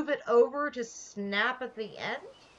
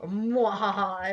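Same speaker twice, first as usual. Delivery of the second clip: slow villain's evil cackle; evil laugh; 2 semitones lower, slower than usual. Pretend. Gloating over the build.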